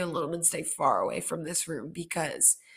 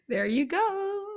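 squeaky laugh through words; high giggling; 14 semitones higher, slower than usual. Real, not acted. Laughs at own burp.

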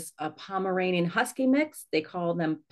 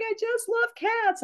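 much higher, slow, teary wail; mock crying; slower than usual. Quoting a weepy woman from a dating site, mock sobbing. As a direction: imitate a woman sobbing over her cats, hammed up. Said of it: "where she was crying".

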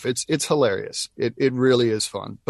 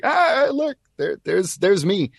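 excited; 9 semitones higher, 4 dB louder, slower than usual. Retold or imitated. Spotting himself in dubbed show.

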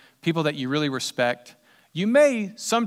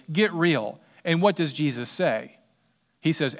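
usual speaking voice

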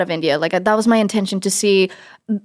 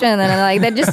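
ordinary talk, nothing marked

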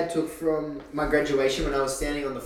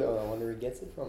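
disappointed mutter; 6 semitones lower, 13 dB quieter, faster than usual. Pretend. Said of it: "disappointed with".

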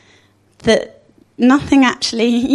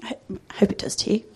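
whispered aside; 8 dB quieter, faster than usual. Real, not acted. Aside to congregation.

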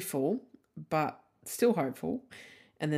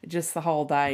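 ordinary talk

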